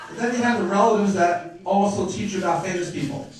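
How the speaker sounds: normal talking voice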